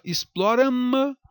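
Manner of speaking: "exploram" com M final de consoante, ex-plo-ra-ma; pronúncia errada. Shown as a demonstration. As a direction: solte o M de "exploram" numa sílaba "mâ". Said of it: "você fala exploram ou". ("exploram" com "mâ" extra no fim)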